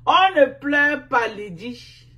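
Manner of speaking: high, shouted mock command; loud taunt. Real, not acted. Streamer scolding crying Lydie, loud and taunting.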